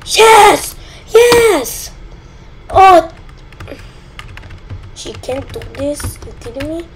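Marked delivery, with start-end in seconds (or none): 0.1-3.1 high, loud yes yes; triumphant cheer. Real, not acted. Player celebrating a win, high loud cheer. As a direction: shout in real victory.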